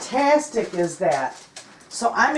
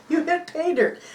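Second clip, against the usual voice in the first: pitched higher, mock husband's catchphrase; a playful imitation of the husband's saying; imitating husband; 9 semitones higher than usual. Wife quoting husband's pet phrase, raised playful mimic. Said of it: "that's what he always says".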